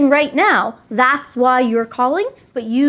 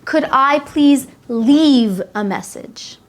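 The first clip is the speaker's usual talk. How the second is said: slow and clear; much slower than usual. Shown as a demonstration. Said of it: "your action".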